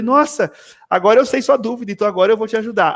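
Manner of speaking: high, quickening retold delight; moved excitement. Teacher finally learns the pupil's doubt, retold excited reaction. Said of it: "aquilo me emocionou muito".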